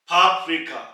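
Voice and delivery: फाफ्रिका said loudly, falling at the end; teacher's model word. Shown as a demonstration. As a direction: say फाफ्रिका clearly for the class. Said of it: "teacher models the word". (फाफ्रिका को ज़ोर से, गिरती लय में)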